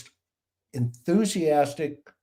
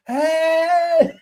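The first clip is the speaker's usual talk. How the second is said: high, loud shout back; answering yell; 6 dB louder than usual. Retold or imitated. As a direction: bellow the reply from the other boat. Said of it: "the other guy stands up and he goes".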